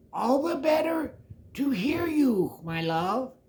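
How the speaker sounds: high, sugary fake granny voice; the wolf sweetly pretends to be Grandma.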